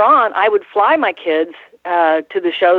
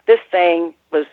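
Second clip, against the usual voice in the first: normal talking voice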